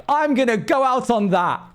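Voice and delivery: high-pitched exit line impression; imitated AI dog. Retelling the dog leaving after his joke, high mimicking voice. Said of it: "we'll be like".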